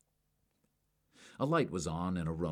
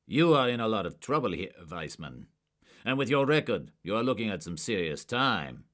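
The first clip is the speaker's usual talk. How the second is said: loud, higher and hard; stern threat; 4 semitones higher, 7 dB louder than usual. A narrator's reading. Agent warning a suspect, loud and stern. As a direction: lean on him with the threat.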